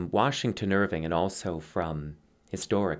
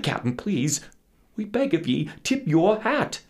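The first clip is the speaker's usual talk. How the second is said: high, strained begging; desperate plea; 9 semitones higher than usual. A narrator's reading. Little Dutchman begging captain to tip hat, desperate. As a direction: beg him, pitch climbing.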